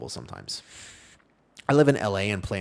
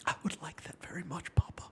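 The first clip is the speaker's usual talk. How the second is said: breathy mock whisper, higher; tearful whisper; 7 semitones higher, 7 dB quieter than usual. Son answering dad, mock tearful whisper. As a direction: whisper it like a moved child.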